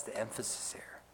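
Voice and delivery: hushed whispered aside. Real, not acted. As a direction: lean in and whisper the point. Preacher drops to aside.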